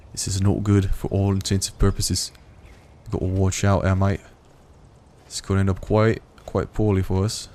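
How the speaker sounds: a light put-on Australian accent; playful, loosely done Australian accent, with "mate". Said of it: "it started off being kind of a bit of Australian accent".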